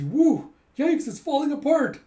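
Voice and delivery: high, loud yikes-style outcry; acted alarm. Cable car riders seeing rubble fall, loud alarmed cry. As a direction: yelp it in mock panic.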